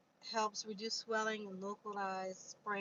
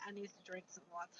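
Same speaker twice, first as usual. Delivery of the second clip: rushed, much softer aside; quick mutter; 2 semitones lower, 10 dB quieter, much faster than usual. Real, not acted. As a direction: mumble it fast to yourself. Hot, muttering aside about water.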